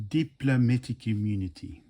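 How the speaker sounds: put-on lightly done South African movie villain accent; half-hearted South African villain impression, smug. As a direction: quote the Lethal Weapon villain in a mock South African accent. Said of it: "was it Lethal Weapon two?".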